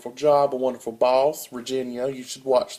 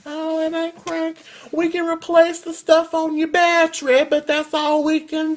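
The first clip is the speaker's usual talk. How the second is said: high falsetto know-it-all repairman; mocking the mechanic; 12 semitones higher than usual. Imitating the Walmart mechanic explaining the battery, high mock voice. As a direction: chirp it like a smug mechanic.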